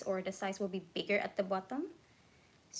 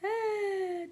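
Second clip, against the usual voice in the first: surprised cry; 11 semitones higher than usual. Real, not acted. Shocked at the time.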